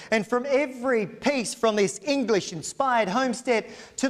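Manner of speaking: polished, persuasive property pitch; auctioneer's sales pitch. Acted. Auctioneer selling the estate to buyers, persuasive showman pitch. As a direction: sell the estate to the crowd with flourish.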